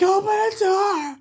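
imitated kid yelling. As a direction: wail it like a kid locked out. Kids yelling at locked bedroom door.

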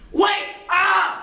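high, loud full shout; commanding shout. Acted. Mister Smith jolts the senators to attention, shouting. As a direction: yell to rouse the whole chamber. Acted yell at inattentive senators.

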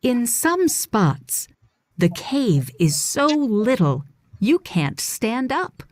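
normal talking voice

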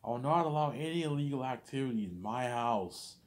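high, slow furious yell at the son; hammed-up outraged mom; angry parent yelling. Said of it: "my parents are like".

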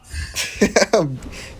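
real amused chuckle; laughing. Real, not acted. Gamer hears a noise at home, amused laugh.

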